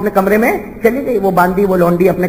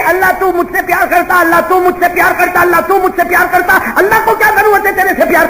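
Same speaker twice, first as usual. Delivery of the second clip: mocking quote; 10 semitones higher than usual. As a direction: throw her words back at her. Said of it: "وہ تو تو کہہ رہی ہے".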